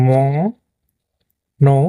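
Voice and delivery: "ম-নৌ" very slowly, split in two; syllable-by-syllable pronunciation. Shown as a demonstration. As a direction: stretch "ম-", hold a pause, then give "নৌ". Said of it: "এটা বাংলা লিখলে হবে".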